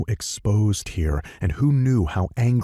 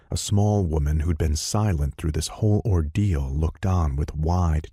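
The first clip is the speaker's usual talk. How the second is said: ordinary delivery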